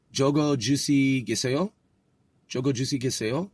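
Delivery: "Jogo Juicy Giseo" with rising "Giseo"; questioning intonation. Shown as a demonstration. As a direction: practise "Giseo" lifting at the end like a question. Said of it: "now that's gotta be a question".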